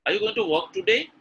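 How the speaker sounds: high voice climbing on "today"; rising tune. Shown as a demonstration. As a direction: swing "today" upward as a question. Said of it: "called a rising pattern".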